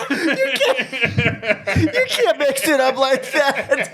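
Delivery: high, slow words broken by laughter; can barely get it out for laughing; wheezing with laughter at the absurd combo; laughing through it. Real, not acted. Cracking up at the menu.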